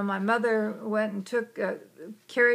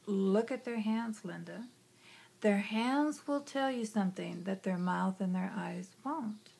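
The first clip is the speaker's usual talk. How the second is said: much softer, fading hushed guidance; soft inner voice; 7 dB quieter than usual. Retold or imitated. Inner voice guides Linda at work, hushed. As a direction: murmur it like a quiet inner guide.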